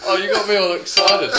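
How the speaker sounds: real laughter in the voice; laughing. Real, not acted. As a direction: still cracking up as you speak. Laughing at the accent trick.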